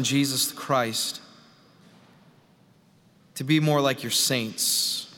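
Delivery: earnest prayer with long pauses between petitions; public prayer. Real, not acted. Pastor asking the Lord to open the word, earnest prayer. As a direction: lead the room in prayer, phrase by measured phrase.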